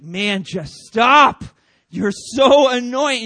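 loud, drawn-out mock-exasperated yell; exasperated outburst. Preacher voicing someone fed up with annoying people, exasperated. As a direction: blow up in acted frustration, stretching each word.

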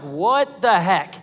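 drawn-out exasperation; slow frustrated aside. Retold or imitated. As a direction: let the frustration stretch out. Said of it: "I'm like".

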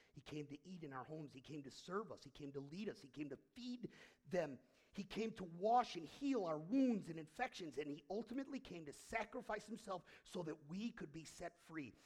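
ordinary talk, nothing marked

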